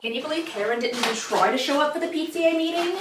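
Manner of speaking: gossipy eighties mom voice; eighties mall mom. Acted. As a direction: play a gossiping power-walk mom. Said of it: "a nineteen eighties mom that power walks".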